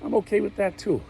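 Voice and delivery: a hint of a second personality chiming in; another voice in his head. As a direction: pop in as a different voice from inside his head. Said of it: "I got four voices in my head".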